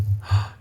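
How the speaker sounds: sharp, voiceless intake of breath; startled gasp. Real, not acted. Player startled by what he did, startled gasp. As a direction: gasp in surprise.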